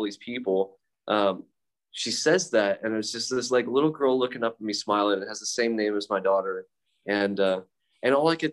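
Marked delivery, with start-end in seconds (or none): none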